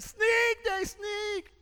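put-on very high, loud toddler shriek; little girl shrieking. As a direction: scream it like a scared three-year-old. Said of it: "she said".

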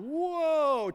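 a loud, high whoa of surprise; amazed whoa. Retold or imitated. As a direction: gasp it out in wonder. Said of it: "And I was going".

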